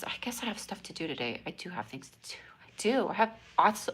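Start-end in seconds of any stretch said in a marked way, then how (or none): 1.5-2.7 unvoiced whisper. Real, not acted. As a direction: whisper it to yourself. Hushed insistence.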